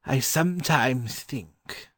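slow, deliberate musing; slowly. A narrator's reading. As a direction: draw the words out as a pondering scholar. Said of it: "he said slowly".

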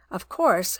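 ordinary delivery, nothing marked

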